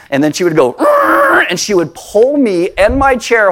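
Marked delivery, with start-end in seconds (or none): 0.8-1.4 high, shouted growling arr; dog growl. Retold or imitated. Pastor voicing his dog lunging at Bob, shouted growl. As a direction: let the big dog lunge with a yelled arr.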